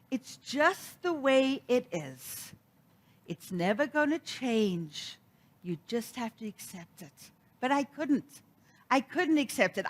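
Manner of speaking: ordinary talk, nothing marked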